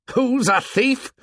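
high, slow, menacing question; indignant, high-pitched retort; angry challenge. A narrator's reading. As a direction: fling the accusation back, drawn out and high. Jack challenges Ralph mid-fight.

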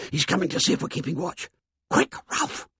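hurried, urgent whispering; hushed warning. Acted. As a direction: hiss a quick warning unheard by others. Hushed warning as someone climbs towards them.

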